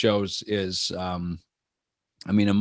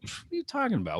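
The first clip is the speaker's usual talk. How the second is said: high, soft disbelieving question; baffled by a refused invite; incredulous; 12 semitones higher, 7 dB quieter, faster than usual. Retold or imitated. Friend hearing a party invite turned down, high incredulous.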